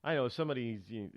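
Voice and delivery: usual speaking voice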